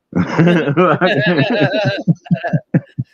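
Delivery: long, high-pitched laughing fit; real laugh. Real, not acted. Storyteller after insulting a cop, real laughter. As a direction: crack up at your own punchline.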